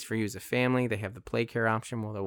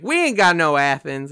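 put-on Texas accent; 4 semitones higher, 9 dB louder than usual. Texan clerk denying any Athens exists, loud Texas accent. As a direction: play a blunt Texan clerk. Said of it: "Is that an offensive accent?".

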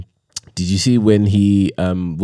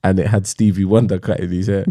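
ordinary talk, nothing marked

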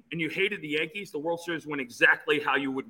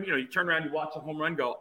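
ordinary talk, nothing marked

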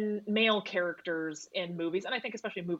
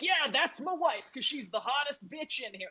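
hammed-up smitten clubgoer; imitating a guy in a club; 7 semitones higher than usual. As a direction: parody of a man picking his future wife. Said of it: "he's gonna be like".

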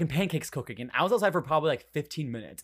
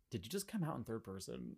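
much quieter, lower deadpan question; retold stepmom's question; 4 semitones lower, 17 dB quieter than usual. Imitating stepmom reacting to a coming out, quiet and puzzled. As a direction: ask it softly, mildly puzzled. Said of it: "my stepmom goes".

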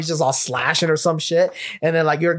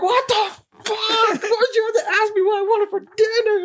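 high-pitched screechy outburst; acted gamer rage. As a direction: shriek it in hammed-up outrage at being interrupted. Acting gamer raging at girlfriend.

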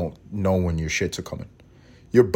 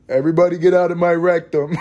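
high, loud replay of an outburst; hammed-up call to everybody; mock sound bite; 9 semitones higher, 5 dB louder than usual. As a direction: throw it out high and loud, as a joke. Imagined clip played against him.

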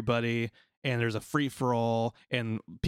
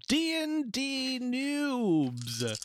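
slow, high, booming show-title announcement; old-timey radio announcer; 12 semitones higher, much slower than usual. Acted. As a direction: announce it like a 1950s radio host. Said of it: "You're like one of those old-timey radio guys".

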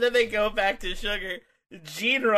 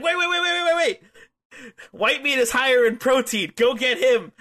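high, loud frantic bargaining; mock panicked plea; 7 semitones higher, 5 dB louder than usual. Sugar begs zombies to go after Gene, mock panic. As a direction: talk the attackers off you in exaggerated panic.

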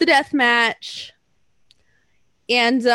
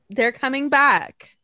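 ordinary talk

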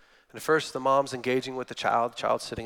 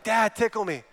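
high-pitched imitation of an eager little girl; young daughter; 7 semitones higher than usual. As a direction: plead like a small child wanting play. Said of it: "My daughter always asks me, like".

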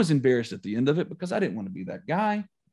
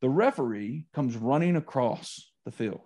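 ordinary talk, nothing marked

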